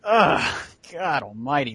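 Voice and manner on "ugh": loud, higher-pitched groan of disgust. Real, not acted. Co-host reacts to a putrid episode, disgusted groan. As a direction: groan in sheer exasperation.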